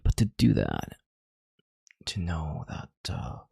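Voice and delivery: normal talking voice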